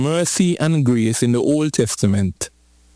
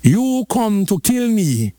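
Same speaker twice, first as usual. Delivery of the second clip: slow emphatic; 6 semitones higher, slower than usual. Real, not acted. Preacher building emphasis.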